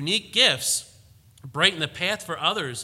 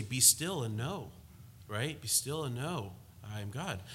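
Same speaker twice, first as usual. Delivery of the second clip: softer, reverent reading of the verse; soft scripture quote; 4 semitones lower, 10 dB quieter than usual. Retold or imitated. Pastor quoting scripture to the flock: soft and reverent. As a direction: hush it down, like scripture read aloud. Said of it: "the scripture would say".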